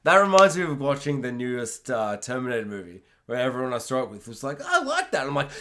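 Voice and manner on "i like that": high, soft, laughing imitation of friends; mock high quote. Friends after the Terminator film, mock high chirp. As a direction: chirp it lightly like pleased friends.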